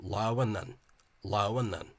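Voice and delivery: "Lauanan" slow, all three syllables clear, stress on the first; three-syllable form. Shown as a demonstration. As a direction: spell "Lauanan" out in three beats. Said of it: "it's three syllables".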